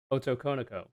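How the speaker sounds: "otokonoko" in an anglicized English accent. Shown as a demonstration. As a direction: say "otokonoko" like an English speaker would. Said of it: "in an anglicized accent".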